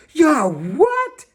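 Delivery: high, loud shrieking scream; screamed outrage. A narrator's reading. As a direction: shriek it in shock. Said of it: "Bombolona screamed".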